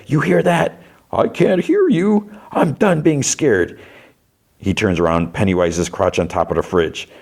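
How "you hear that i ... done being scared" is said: high-pitched, drawn-out mock shout; imitated shout. Boy stands up to the clown, imitated high defiant shout. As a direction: cry out defiantly high, without full volume. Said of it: "He shouts, like".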